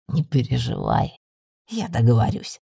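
low male familiar voice; deep-voiced companion calming his mistress; reassuring familiar. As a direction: reassure her, deep and unhurried.

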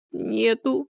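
high, weak moan. A narrator's reading. Людмила admits her trouble to Галина, moaning. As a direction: moan the word out miserably. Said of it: "простонала та".

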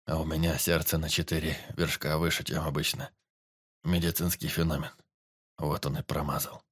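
a low, weak voice of a dying forester; wounded forester. A narrator's reading. Wounded forester to the teacher, low and weak. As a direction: push each word out through the pain.

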